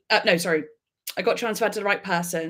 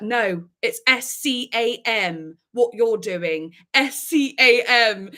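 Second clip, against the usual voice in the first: slow, separated letters S C A M; drawn-out, emphatic S C A M; defiant spelling; 2 semitones higher, 4 dB louder, slower than usual. Retold or imitated. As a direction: spell out S C A M letter by letter, hitting each. Spelling out scam defiantly to caller.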